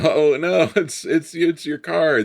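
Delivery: high-pitched mock pleading; mock protest. [name] explains the cars' love to Diane, high mock protest. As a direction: imitate a flustered excuse, pitched well up.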